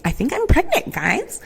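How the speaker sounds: high, rising, sing-song "I think I'm pregnant, guys"; mock announcement. Teasing friends with a high sing-song pregnancy reveal. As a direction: pitch it way up like a cheesy reveal to friends. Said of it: "I didn't wanna be like".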